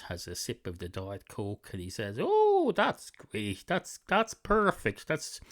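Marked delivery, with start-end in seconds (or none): 2.2-5.1 sudden high squeal of delight, then settling; a cat thrilled by the first sip. Acted. Cat tastes the Diet Coke.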